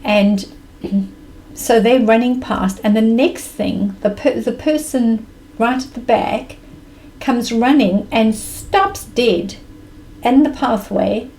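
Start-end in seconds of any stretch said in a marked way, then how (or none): none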